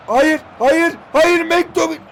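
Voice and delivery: alarmed shout. Real, not acted. Opponent attacks, he protests.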